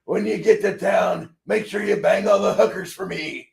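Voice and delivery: put-on high, loud old-timer send-off; put-on high voice, calling out like an old man. Trucker quoting the old man from the movie, old-timer voice. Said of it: "I just need the old man to tell me".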